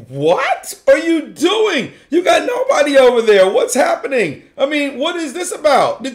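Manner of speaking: high, incredulous outburst; acted bewildered protest; mock exasperation. Acts out exasperation at odd move.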